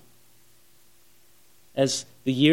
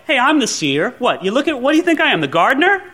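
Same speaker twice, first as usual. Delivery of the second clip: high, louder, irritated retort; annoyed; 6 semitones higher, 4 dB louder than usual. Shown as a demonstration. Samuel asking if he looks like the gardener, annoyed. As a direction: get testy, pitch climbing to the end. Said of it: "Was it kinda annoyed?".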